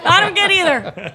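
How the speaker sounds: loud, high, laughing complaint; comic protest. Real, not acted. Left out of praise for the horse, loud laughing protest. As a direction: wail it out in playful outrage.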